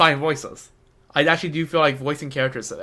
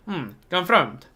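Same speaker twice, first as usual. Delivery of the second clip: half-hearted Fatui; 3 semitones higher than usual. Acted. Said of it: "Half-hearted fatuous".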